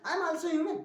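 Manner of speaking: high then sinking mock plea. Shown as a demonstration. Teacher to class, mock pleading for understanding.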